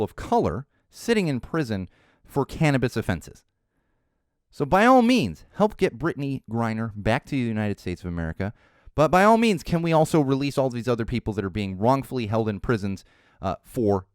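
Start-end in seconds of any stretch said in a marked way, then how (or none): 4.5-5.4 "all" punched high and loud; "by ALL means" thrown up high; stress on all. Real, not acted. Emphatic political rant. 8.9-9.6 "all" punched high and loud; "by ALL means" thrown up high; stress on all. Real, not acted. Emphatic political rant.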